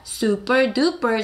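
super duper drawn out slowly; teacher modelling slowly; slow model phrase. Shown as a demonstration. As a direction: stretch out super duper evenly. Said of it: "시작".

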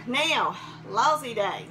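breathless after cheering; out of breath. Real, not acted. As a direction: talk while catching your breath. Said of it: "Now I'm out of breath".

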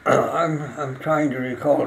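ordinary talk

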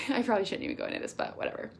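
quick, hushed giggling aside, fading to a breathy whisper; laughing aside. Real, not acted. Coach laughs to her team, half-hiding a risky topic, quick and hushed. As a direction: let the aside spill out fast through a laugh, then drop to a whisper.

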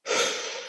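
long theatrical sigh out; exaggerated sigh. Shown as a demonstration. Judging someone with a long disdainful sigh. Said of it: "the sigh".

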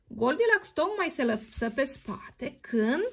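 ordinary delivery

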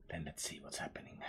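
fast hushed whisper, voice dropped away. Real, not acted. Gamer whispering while peeking at the boss. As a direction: whisper it quickly like sneaking up on someone.

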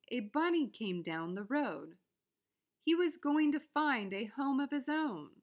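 ordinary delivery

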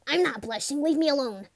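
loud, high embarrassed protest; high-pitched indignant outburst; flustered denial. Acted. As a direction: shout it defensively, flustered. Flustered denial when teased.

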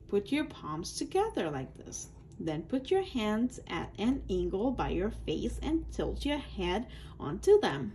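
ordinary delivery

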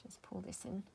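very soft whispered aside. Real, not acted. Crafter pouring glue from a clogged bottle, whispered. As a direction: whisper it while concentrating.

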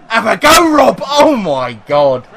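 high, loud, then slow amazed cry; excited shout. Real, not acted. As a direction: cheer the defender on, then gasp at it. Defender shoots, gamer amazed.

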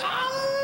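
a shrill ow melting into a high giggle; falsetto yelp and giggle. Acted. Dude squeals and giggles at the party, very high.

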